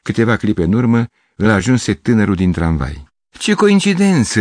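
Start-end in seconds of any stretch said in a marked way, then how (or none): 3.3-4.4 much higher, slow, delighted surprise; surprised exclamation. Acted. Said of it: "exclamă Gavrilescu". (exclamație surprinsă, mult mai sus și rar)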